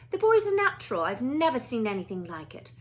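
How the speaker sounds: clipped headmistress asking politely; a stern older Scottish witch at a classroom door; McGonagall voice. Acted.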